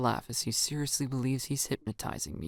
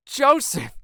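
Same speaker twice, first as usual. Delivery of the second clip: much higher, loud, thrilled; excited shout; 10 dB louder than usual. Acted. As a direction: shout his name in delight. Excited friend calls out.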